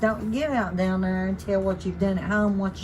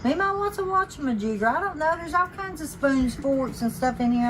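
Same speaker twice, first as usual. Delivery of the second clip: put-on child voice; 7 semitones higher than usual. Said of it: "they are just like".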